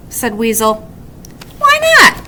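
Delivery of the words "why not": eager. A narrator's reading. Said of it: "said Woodchuck".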